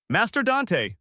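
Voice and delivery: high-pitched call. A narrator's reading. A voice summoning Master Dante, calling out. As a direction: call his name from beyond the door. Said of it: "a voice called out".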